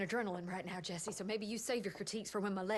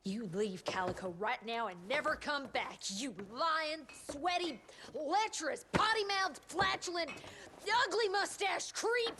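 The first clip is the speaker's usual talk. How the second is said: building angry tirade; 9 semitones higher than usual. Acted. Doctor telling off the secretary, angry tirade building to a shriek. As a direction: pile on the insults until she is shrieking, slowing at the end.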